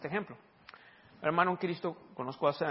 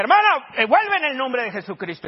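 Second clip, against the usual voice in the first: much louder and higher, calling her back; commanding prayer; 8 semitones higher, 12 dB louder than usual. Retold or imitated. Preacher calling the dead sister back to life, commanding shout. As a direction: command her return with authority.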